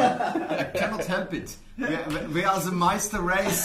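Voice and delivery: put-on loud, drawn-out comic German accent; mock German accent. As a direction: slip helplessly into hammy German. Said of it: "always break back into German".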